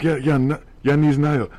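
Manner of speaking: broken English with Russian words; Russian accent. Retold or imitated. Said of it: "we're like".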